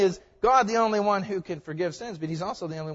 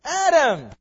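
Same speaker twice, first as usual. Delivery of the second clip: louder call; 9 semitones higher, 9 dB louder than usual. Pretend. God calling hidden Adam a second time, louder call. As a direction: call the name once more, louder and more insistent.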